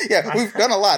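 high laughing reply; laughing, real amusement at the question. Real, not acted. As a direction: laugh your way into the answer.

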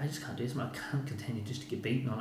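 soft, understated resignation; subdued retold despair; defeated. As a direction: quietly voice a past moment of giving up. Retells despair after repeated losses.

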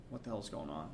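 quiet, low self-quote after the yell; low puzzled aside. Retold or imitated. Teller wondering what his friend is yelling about, low puzzled aside. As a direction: drop right down, as if asking under the noise.

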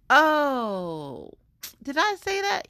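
loud drawn-out oh, then high feigned innocence; mock shock. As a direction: play scandalized at your own slip. Mock shock at own indiscretion.

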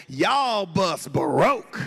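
slow, drawn-out Southern country drawl; country mechanic. Retold or imitated. Greasy mechanic tells the youth group, in a slow country drawl. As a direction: stretch every word like a country mechanic. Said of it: "he goes".

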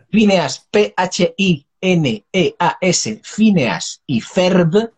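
very slow, each letter separate; spelling it out. Shown as a demonstration. Telling listeners what to search on YouTube, spelling it out slowly.

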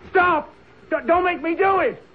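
very high, loud, breaking yell; panicked shout. Acted. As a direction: yell it in terror, finger on trigger. Carter panics before shooting.

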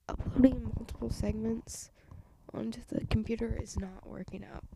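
usual speaking voice

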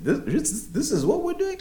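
climbing high, incredulous question; shocked disbelief. Retold or imitated. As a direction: let the pitch climb as you question it. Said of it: "I was honestly shocked".

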